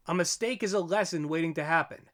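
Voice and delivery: ordinary talk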